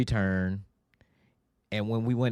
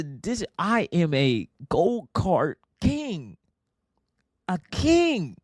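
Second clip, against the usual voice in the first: high, slow pompous boast; mock grandiose; 7 semitones higher, slower than usual. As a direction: crown yourself in mock pride. Boasting grandly about himself.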